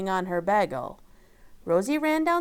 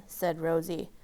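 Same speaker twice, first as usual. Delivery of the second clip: plain reading voice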